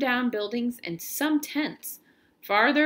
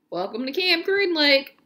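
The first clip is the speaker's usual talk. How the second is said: bus driver voice; 7 semitones higher, 5 dB louder than usual. Acted. Bus driver greets arriving boy.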